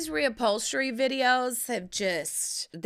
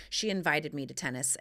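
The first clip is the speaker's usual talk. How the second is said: normal talking voice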